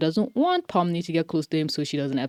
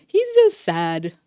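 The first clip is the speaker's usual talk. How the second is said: normal talking voice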